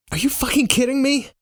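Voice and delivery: high, incredulous outburst; outraged disbelief. Acted. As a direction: protest in panicked disbelief. Narrator disbelieving amid snake panic.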